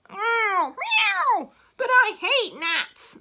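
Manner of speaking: high, loud meows, then slow loud complaint; cat meowing. Acted. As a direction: yowl like a cross cat. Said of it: "said the cat".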